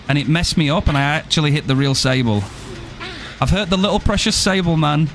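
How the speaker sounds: ordinary delivery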